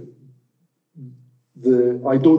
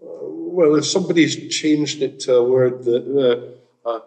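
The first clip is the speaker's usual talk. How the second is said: ordinary talk, nothing marked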